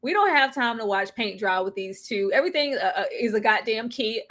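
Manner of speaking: usual speaking voice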